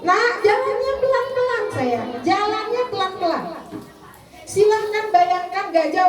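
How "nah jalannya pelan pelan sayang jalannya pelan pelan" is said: high, coaxing parent voice; positive gentle reminder. Acted. Parent to running child using positive language, gentle coaxing. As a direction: gently guide a child in a raised, lilting pitch. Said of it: "jadi gunakan bahasa yang positif".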